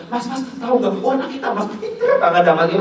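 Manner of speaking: high, eager chatter; chattering wife. Retold or imitated. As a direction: gush to the husband about the kid. (istri bercerita dengan penuh semangat)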